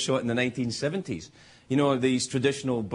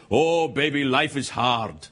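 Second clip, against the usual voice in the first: put-on Russian accent; slower than usual. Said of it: "this big Russian woman ... she said".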